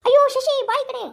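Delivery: high, loud cry of alarm; alarmed warning. Acted. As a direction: call out urgently to get out of danger. Acted warning during an attack.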